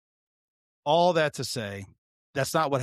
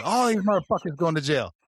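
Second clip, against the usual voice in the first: mild re-enacted yell, raised pitch; retold yelling; 4 semitones higher than usual. Officer repeating his answer to the sergeant, yelling. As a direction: yell it indignantly, partly held back. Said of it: "I was yelling".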